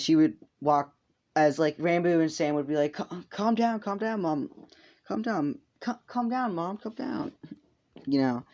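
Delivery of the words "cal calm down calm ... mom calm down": pleading sons. Retold or imitated. Ranboo and Sam calming their mom, high pleading voice. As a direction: imitate anxious kids soothing their mom, pitched way up. Sons try to calm angry mother.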